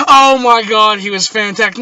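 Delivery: loud, high mock rave; mock gushing. Husband asked about the other husband, mock gushing. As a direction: gush over him, hammed-up. Sarcastic answer to wife.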